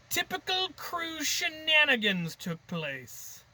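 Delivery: high, slow goofy voice; playful high cartoonish aside; silly put-on voice. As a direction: stretch it out like a cartoon.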